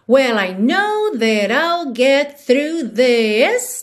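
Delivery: slow, "this" held long at the end; THIS drawn out. Shown as a demonstration. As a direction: stretch the final "this". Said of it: "Também alonga esse this aí no final".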